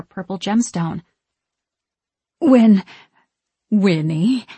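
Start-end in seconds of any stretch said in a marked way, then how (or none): 2.4-4.5 loud false start, then rising appeal; nervous sister getting attention; tentative venturing. A narrator's reading. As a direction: break off and try again cautiously. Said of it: "Mary ventures".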